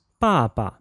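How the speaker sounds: 爸爸 falling, then short and low; fourth plus neutral tone. Shown as a demonstration. Teacher models 爸爸 for repetition, falling then neutral tone. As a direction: drop the first syllable of 爸爸, keep the second light. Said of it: "B A with a fourth tone".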